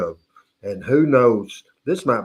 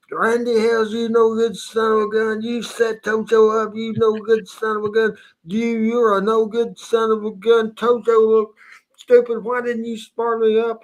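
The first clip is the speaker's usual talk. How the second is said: mad Tojo impression; 8 semitones higher than usual. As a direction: play the wrestler ranting at his buddy who set him up. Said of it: "I knew he was mad".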